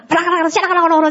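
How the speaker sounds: quick high patter imitating praying in tongues; fast mock tongues prayer; rapid tongues babble. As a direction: rattle it off fast like speaking in tongues. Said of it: "这样子方言祷告".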